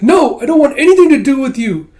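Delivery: high, loud, heated refusal; angry refusal. Acted. Kippa rejects the visitor, angry refusal. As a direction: shout them away at a high pitch.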